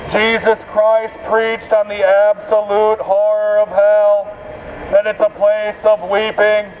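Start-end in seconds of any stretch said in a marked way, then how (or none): none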